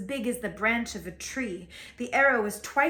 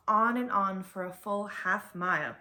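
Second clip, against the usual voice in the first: ordinary delivery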